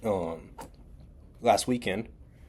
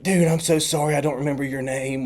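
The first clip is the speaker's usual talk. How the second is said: higher-pitched imitation of a sorry guy; flustered apology; 6 semitones higher, 3 dB louder than usual. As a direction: act out an embarrassed apology. Acting the flustered, apologetic stranger.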